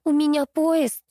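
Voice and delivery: high, slow indignant protest. A narrator's reading. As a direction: protest indignantly, stretching the words. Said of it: "Нина возмутилась".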